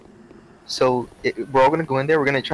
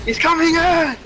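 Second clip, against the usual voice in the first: panicked yell; 5 dB louder, slower than usual. Real, not acted. Friend chased by enemy, yelps.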